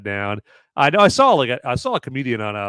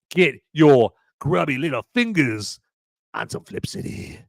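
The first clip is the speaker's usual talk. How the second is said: creepy. Pretend. Selling a magazine like a lurking creep, creepy hush. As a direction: creep it out like a shady stranger. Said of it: "I made that sound really creepy".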